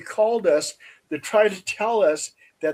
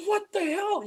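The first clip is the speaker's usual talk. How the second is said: much higher, startled "What the hell?"; startled exclamation; 13 semitones higher, 6 dB quieter than usual. Retold or imitated. Woman spotting the kids down the road, high startled cry. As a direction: jolt of disbelief, pitched way up.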